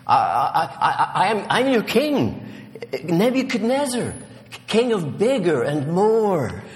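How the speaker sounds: high, stammering royal bluster; stammer, then puff up as a scared king; pompous but frightened, pitched high; nervous bluster. A narrator's reading. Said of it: "murmured with as much bluster as he could manage".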